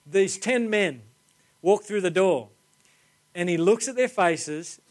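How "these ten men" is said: normal talking voice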